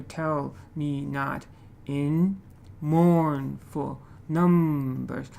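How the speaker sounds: "Tell me not in mournful numbers" slowly, beat by beat; slow, exaggerated metre with pauses round "in"; alternating stress. Shown as a demonstration. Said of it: "like that. So alternating stress and unstressed patterns".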